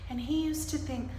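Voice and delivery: ordinary delivery, nothing marked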